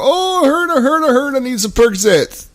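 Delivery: high whiny mock patient begging for pills. Mocking pill-seeking patients to a fellow vet, high whine. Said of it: "you hear all kinds of motherfuckers".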